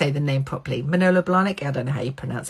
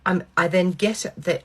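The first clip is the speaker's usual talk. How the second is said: ordinary delivery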